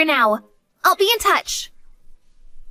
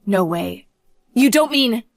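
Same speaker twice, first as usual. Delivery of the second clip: ordinary delivery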